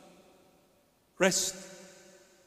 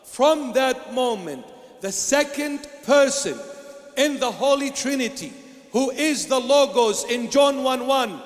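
fervent preaching; 4 semitones higher, 4 dB louder than usual. Acted. Fervent preaching about Christ.

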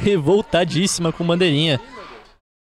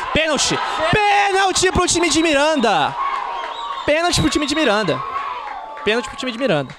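explosive penalty call; 11 semitones higher than usual. Real, not acted. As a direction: yell the penalty, then settle. (grito de pênalti explosivo)

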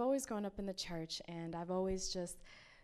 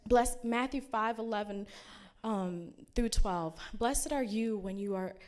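fervent scripture reading; 2 semitones higher, 6 dB louder than usual. Acted. Reads Beatitudes with fervor.

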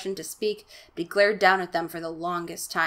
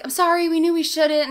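mock Margaret; 10 semitones higher, 7 dB louder than usual. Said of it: "Margaret's like".

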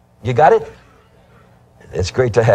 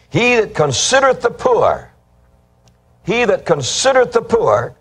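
raised preaching; 8 semitones higher than usual. Acted. Preacher repeats verse, raised.

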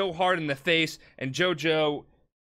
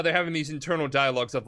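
ordinary talk, nothing marked

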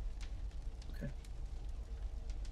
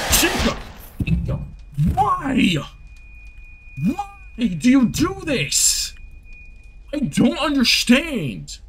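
frustrated yell; 10 semitones higher, slower than usual. Real, not acted. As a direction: plead with the game in disbelief. Streamer yells at game character Chica.

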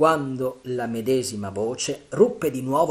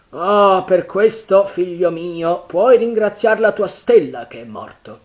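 loud, high, exclaiming character voice; hearty pirate chief; 7 semitones higher, 13 dB louder than usual. A narrator's reading. Silver answering his frightened man, loud and hearty. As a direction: boom it out with relish.

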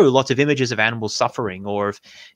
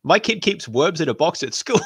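fast, higher, hammed-up mimic of the host; mocking imitation; 5 semitones higher than usual. Viewer mocks the host's claim about his son, fast mocking mimicry. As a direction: rattle it off in a silly mocking voice. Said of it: "It's like".

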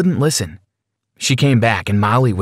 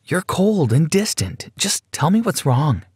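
slow, higher pleading plea; pleading girlfriend; 6 semitones higher than usual. Acted.

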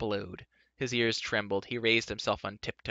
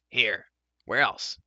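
a louder curt snap; snapped; 5 dB louder, slower than usual. A narrator's reading. Dolly snapping back at Terran, louder and curt. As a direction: bite the words off. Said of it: "snapped a voice".

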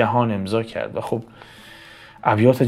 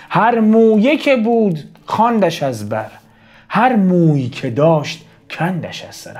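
high, ringing declamation of a lament; poem recitation; 8 semitones higher, 6 dB louder than usual. Acted. Teacher recites the mother's lament, high declaimed poem. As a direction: project the lament higher, as verse. Recites verse, then explains.

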